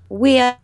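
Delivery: put-on "wheel" in her old accent, drawn into "wee-ul"; accent example. Shown as a demonstration. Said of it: "we need a little example".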